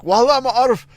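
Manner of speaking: loud pleading Iraqi Arabic wallah ma'aruf; Iraqi Arabic phrase. Retold or imitated. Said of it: "the only phrase I can still remember from Iraq".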